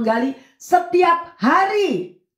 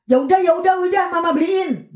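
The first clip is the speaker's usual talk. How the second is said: higher, hurried mock surrender; giving in; 6 semitones higher than usual. Mother pestered by her child finally agrees, exasperated giving in. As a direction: imitate a worn-down parent relenting.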